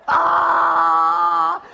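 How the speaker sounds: high, loud, panicked "Ah!"; terrified sheep scream. Retold or imitated. Retelling penned sheep panicking, loud frightened cry. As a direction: shriek like a cornered sheep. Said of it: "And they're like".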